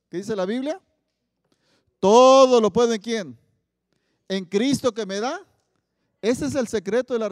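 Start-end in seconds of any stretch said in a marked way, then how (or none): 2.0-5.4 loud, slow call for the crowd to finish; preached verse. Acted. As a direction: lead the congregation through the verse. Congregation completes a Bible verse. (versículo predicado, fuerte y lento)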